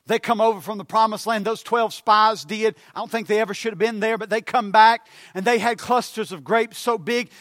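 loud, high, hammering sermon climax; fiery preaching. Acted. Preacher rousing the congregation, loud and fervent. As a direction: preach it hard to the pews.

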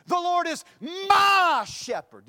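"my" stretched into a high, loud cry; stress on MY. Shown as a demonstration. Preacher shows how emphasis changes meaning, stressing "my". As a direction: shout the word "my" and hold it. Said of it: "you can place emphasis on it".

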